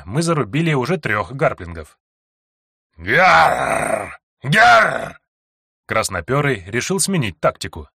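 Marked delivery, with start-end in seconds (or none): 3.0-5.2 loud shrieking bird monster cry; monster screech. A narrator's reading. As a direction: screech like a winged beast.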